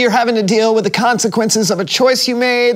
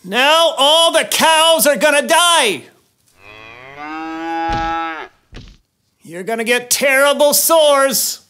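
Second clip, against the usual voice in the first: Moses warning; 5 semitones higher, slower than usual. Acted. Moses threatening plagues to Pharaoh.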